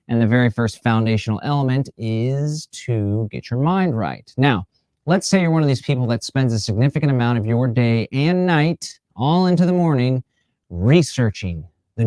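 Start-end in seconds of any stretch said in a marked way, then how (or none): none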